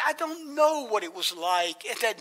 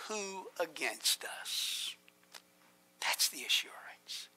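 whispered; 5 semitones lower, 14 dB quieter than usual. Real, not acted. Preacher whispers the rhetorical answer.

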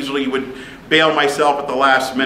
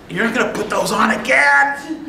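high-pitched, put-on protest; mock disgust; 9 semitones higher than usual. Student balking at stinky, crispy gym clothes, mock disgust.

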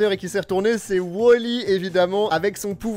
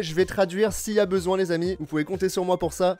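ordinary delivery

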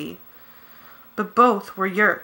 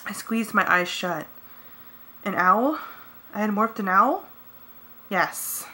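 plain reading voice

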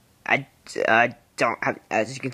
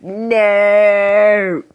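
loud no; 7 semitones higher, 8 dB louder than usual. Retold or imitated. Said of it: "And I'd be like".

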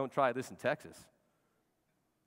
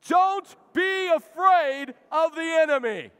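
slow, high, loud declaration with full force; with gusto; 12 dB louder, slower than usual. Shown as a demonstration. Pastor leading the congregation to say it aloud with gusto, shouted slowly. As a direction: proclaim each word like a battle cry. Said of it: "we gotta say it with gusto".

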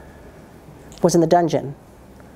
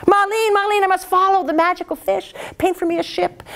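high, quick, greedy urgency; eager emperor; 9 semitones higher, faster than usual. A narrator's reading. Greedy emperor urging Ma Lin, high and eager. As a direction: hurry him with grasping excitement. Emperor chasing magic fish.

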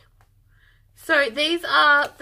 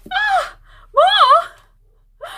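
high, loud squeal of delight; thrilled squeal; 10 dB louder than usual. Real, not acted. Finding more yarn from Carrie, an excited high squeal. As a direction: shriek with joy at finding more. Finds more skeins in package.

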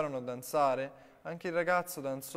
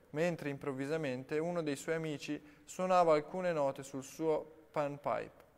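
plain reading voice

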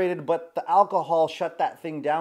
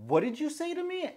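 offended challenge; 10 semitones higher, 4 dB quieter than usual. Retold or imitated. Woman confronting the man who insulted her, mock-outraged. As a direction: imitate an affronted woman, pitch jumping up.

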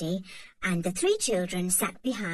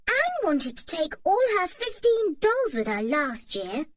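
high, mocking little-brother voice; teasing boy; 9 semitones higher than usual. A narrator's reading. Dick teases Anne about her dolls, high teasing voice. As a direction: poke fun at your sister.

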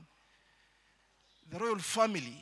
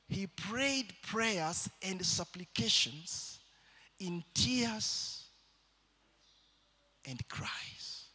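slow preaching; 2 semitones higher, much slower than usual. Acted. Slow tearful sermon telling.

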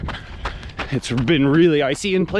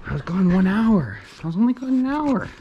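high sing-song doggy talk; 5 semitones higher than usual. Real, not acted. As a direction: tease the dog in a high voice. Telling the dog he was gone.

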